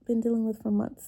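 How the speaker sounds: ordinary delivery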